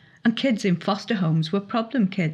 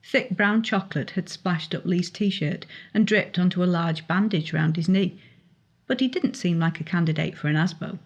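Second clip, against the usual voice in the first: plain reading voice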